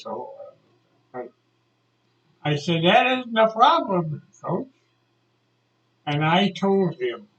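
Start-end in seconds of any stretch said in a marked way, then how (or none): 2.8-4.7 raised, louder retold objection; lightly acted worried student; pupil's protest. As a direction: object urgently to the coach.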